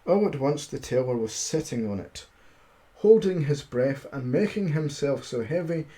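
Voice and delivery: usual speaking voice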